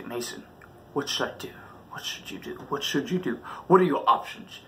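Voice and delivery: breathy whisper to himself; whispered self-talk. Retold or imitated. Boy hiding in the leaves whispering to himself. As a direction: ask yourself quietly in a panic. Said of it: "I said".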